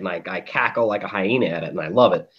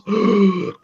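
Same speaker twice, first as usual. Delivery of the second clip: gasp; 9 semitones higher than usual. Retold or imitated.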